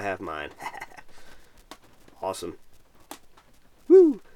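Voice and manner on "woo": cheer. Real, not acted. Late-night stream cheer.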